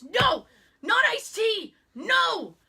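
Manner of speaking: high, loud, drawn-out objection; imitating an appalled, insistent advisor; emphatic refusal. Retold emphatic refusal of iced tea.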